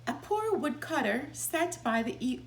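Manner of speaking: ordinary delivery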